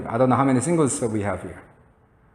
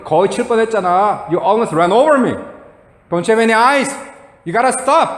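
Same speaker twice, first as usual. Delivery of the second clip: higher-pitched indignant scolding; retold angry shout; 6 semitones higher, much slower than usual. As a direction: re-enact yelling at a careless driver. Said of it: "그렇게 막 소리쳤지".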